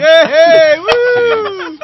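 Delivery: very high, loud yay and woo; whooping cheer. Real, not acted. Co-host cheering a high five, loud whooping. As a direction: cheer at the top of your voice.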